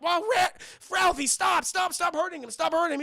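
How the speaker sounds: high, loud pleading shout; acted mum yelling to stop; frantic mother. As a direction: break up the fight urgently. Mother breaks up fight.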